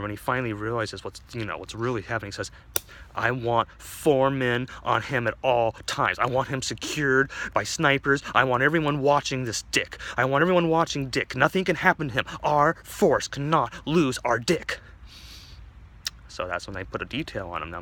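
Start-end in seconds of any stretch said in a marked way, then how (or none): 3.1-14.8 higher, loud emphatic orders; commanding commissioner. Acted. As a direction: bark orders to the whole force, building up. Commissioner issues urgent orders.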